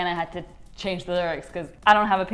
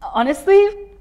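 higher and louder in disbelief; stunned outburst; 11 semitones higher, 8 dB louder, slower than usual. Real, not acted. Reacting to the friend crashing the van, stunned. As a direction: burst out in shock.

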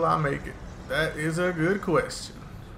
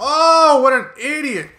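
loud, high startled cry; panicking gamer; 6 semitones higher, 10 dB louder than usual. Real, not acted. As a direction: yelp at the surprise. Reacting to death.

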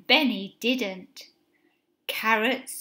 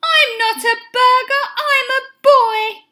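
high, loud protest; shout; 11 semitones higher, 6 dB louder than usual. A narrator's reading. Benny to the hungry boys, high protesting shout. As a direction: shout it out, pitched right up. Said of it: "shouted Benny".

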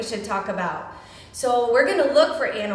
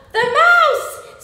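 loud, much higher delighted gasp; excited reveal; 13 semitones higher, 9 dB louder than usual. Real, not acted. Teacher revealing the mouse to children, thrilled. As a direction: announce it with a happy laugh. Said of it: "guessing game reveal".

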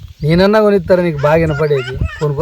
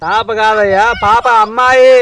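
loud correction; 4 semitones higher, 5 dB louder than usual. Acted. Correcting the deaf uncle loudly.

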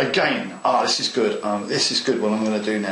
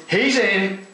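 higher, pleased little cheer; triumphant; 7 semitones higher than usual. Real, not acted. Modeller cheering as the wall goes in. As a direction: crow it happily. Piece glued in place triumphantly.

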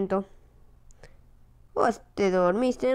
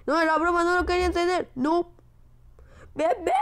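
fast, higher, louder protest; panicked outburst; 9 semitones higher, 3 dB louder than usual. Real, not acted. Gamer panics as the baby ruins it. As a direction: blurt it out in alarm.

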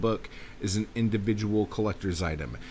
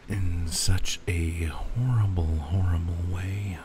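hushed whisper; 5 semitones lower, slower than usual. Real, not acted. Whispering about the horrible remark.

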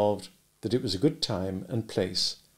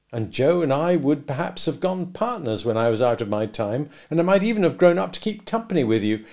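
higher and louder, longing, quickening toward the end; wistful regret; 5 semitones higher, 4 dB louder than usual. A narrator's reading. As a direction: build from regret to a pleading question. Pip voiced, rising and louder.

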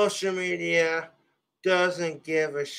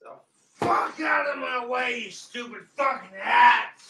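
higher, strained furious yell; angry shout; 5 semitones higher than usual. Real, not acted. Streamer raging at a hat in his way, strained angry shout. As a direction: yell it out, close to breaking.

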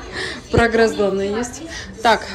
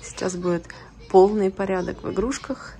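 quiet bedtime voice; 10 dB quieter than usual. Real, not acted. Putting the kids to bed.

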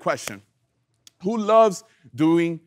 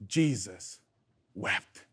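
much softer and lower, almost breathed out; hushed and solemn; 9 semitones lower, 9 dB quieter, much slower than usual. Shown as a demonstration. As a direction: let the words sink in reverently. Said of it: "Come on, say it with me".

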